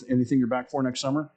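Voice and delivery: usual speaking voice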